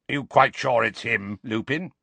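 suspicious growled question. A narrator's reading. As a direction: growl it like a wary old fighter. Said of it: "he growled".

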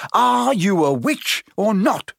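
high, drawn-out exasperation; bellowing. A narrator's reading. Ron to Hermione who forgot magic, bellowing. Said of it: "Ron bellowed".

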